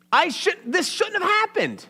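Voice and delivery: a grieving mother blaming the prophet; mild anguished outburst, pitched high; frustrated mother. Acted. Mother's angry complaint voiced.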